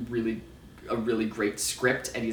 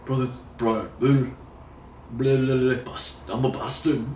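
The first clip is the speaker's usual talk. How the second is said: mumbled mock Game of Thrones accent. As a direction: fumble through a Westeros accent half-joking. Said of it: "Oh, I can't do that accent".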